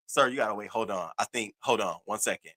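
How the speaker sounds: guard calmly stopping him at the exit; imitating security guard. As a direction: play the guard, low and hushed.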